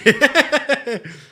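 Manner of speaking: bursts out in a high loud laugh; real laugh at his own joke. Real, not acted. Streamer laughing at his own joke, loud laugh.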